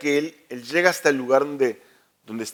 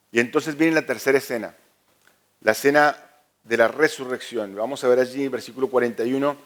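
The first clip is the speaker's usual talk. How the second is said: ordinary delivery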